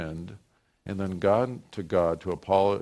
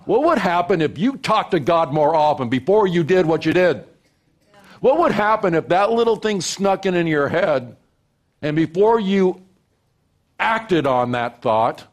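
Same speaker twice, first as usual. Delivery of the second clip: loud, high and pressing; impassioned preaching; 9 semitones higher, 7 dB louder than usual. Real, not acted. Pastor challenging the congregation, loud impassioned questions. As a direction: push the question hard.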